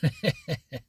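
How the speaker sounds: a quick breathy laugh; short laugh. Real, not acted. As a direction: let a brief laugh slip out. Opens with a laugh.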